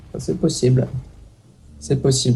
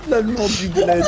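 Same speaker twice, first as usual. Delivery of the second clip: laughing. Real, not acted. Laughing at the German accent joke.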